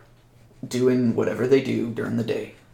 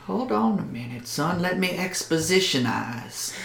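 a lightly voiced yokel telling the boy to wait, softer; joking local, hushed; 6 semitones higher, 6 dB quieter than usual.

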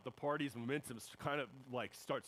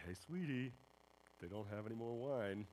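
sweet mom voice; 2 semitones lower, 4 dB quieter than usual. Retold or imitated. Mary softly nudging her son about the wine. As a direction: nudge him kindly, like a mom calling her son sweetie. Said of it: "Sweetie, come on".

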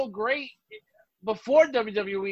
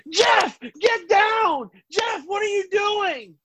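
high, slow, hammed-up commentator yell; mock panicked shouting; 9 semitones higher, slower than usual. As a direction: overact a frantic announcer, much higher and drawn out. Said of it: "When Jeff was going".

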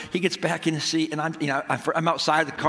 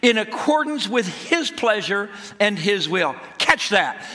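raised, emphatic sermon delivery; preaching; 5 semitones higher, 3 dB louder than usual. Real, not acted. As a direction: drive the point home to a congregation. Preaches after telling the story.

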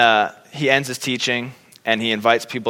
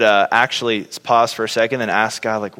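ordinary talk, nothing marked

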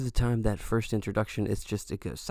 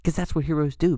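casual hero voice; 7 semitones higher, 4 dB louder than usual. Retold or imitated. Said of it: "be like".

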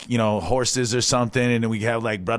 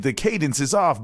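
ordinary delivery

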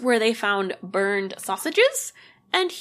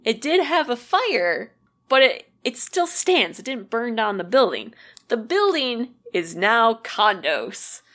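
usual speaking voice

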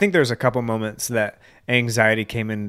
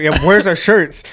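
louder, higher puzzled question; confused protest; 6 semitones higher, 7 dB louder, slower than usual. Retold or imitated. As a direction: ask where the shirts went, baffled. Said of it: "I was like".

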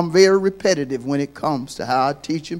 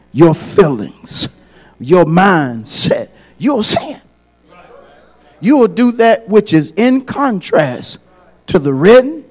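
ordinary talk

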